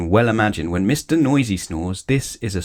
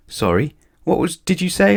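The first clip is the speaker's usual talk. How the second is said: pretending not to hear; 9 semitones higher than usual. A narrator's reading. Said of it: "pretending not to hear".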